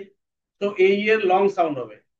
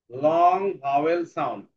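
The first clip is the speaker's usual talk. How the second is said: slow dictation; 3 semitones lower, slower than usual. Shown as a demonstration. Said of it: "teacher repeats the term slowly".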